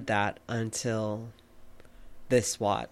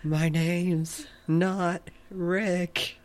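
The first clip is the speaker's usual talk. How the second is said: drawn-out, higher-pitched mock scream; 6 semitones higher, much slower than usual. Co-host quoting Patrick's outburst to the other host, mock scream. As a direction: stretch every word out slowly in an imitated cartoon yell, no real volume. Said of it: "And he screams".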